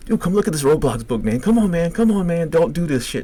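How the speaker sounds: high, pleading retold call; urgent calling out. Inmates at little windows calling him away, urgent. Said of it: "calling this dude's name".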